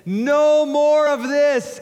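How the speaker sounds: very high, loud, slow command; Jesus commanding. Retold or imitated. Jesus stopping the disciples, high slow loud command. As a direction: stop them dead with it. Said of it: "But Jesus said".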